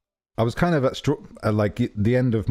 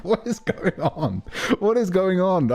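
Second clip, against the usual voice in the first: mock bewilderment, pitched higher; confused; 6 semitones higher than usual. Stranded traveler reacting to a would-be helper who leaves, confused. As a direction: retell it as baffled disbelief. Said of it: "I was so confused".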